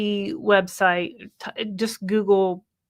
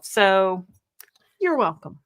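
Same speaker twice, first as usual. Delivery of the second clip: ordinary delivery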